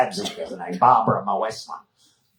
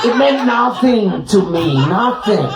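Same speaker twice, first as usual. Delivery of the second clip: mock Austrian woman; 9 semitones higher, 4 dB louder than usual. Babsy explains stalker, high, laughing.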